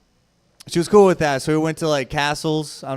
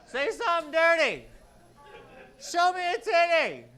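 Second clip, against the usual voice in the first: high put-on voice; 11 semitones higher, 5 dB quieter than usual. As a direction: call it out in a silly falsetto. Acted dirty talk in bit.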